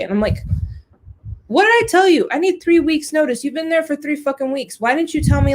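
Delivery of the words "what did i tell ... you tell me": exasperated scolding. Retold or imitated. Scolding mom over late wedding notice, exasperated and raised. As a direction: let the frustration burst out at her.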